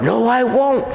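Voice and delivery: high, quick-fire mock refusal; defiant demon. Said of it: "he's going".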